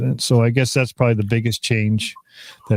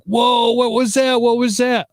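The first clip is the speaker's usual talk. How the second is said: much higher, alarmed mock exclamation; startled viewers; 12 semitones higher than usual. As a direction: play the stunned livestream viewers, up high. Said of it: "Everybody that's watching it on my group is like".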